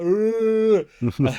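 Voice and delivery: long high mock straining cry; leaping yell. Mike leaping through the air, imitated high yell. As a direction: imitate the drawn-out effort of a huge leap. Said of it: "leaping through the air".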